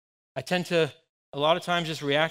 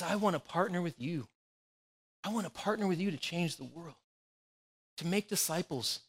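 softer, gentle invitation; heavenly Father; 7 dB quieter than usual. Pretend. Jesus inviting his disciples, soft and tender. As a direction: offer partnership tenderly. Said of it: "he sounded just like his heavenly Father".

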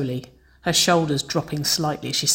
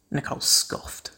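usual speaking voice

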